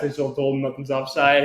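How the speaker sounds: ordinary talk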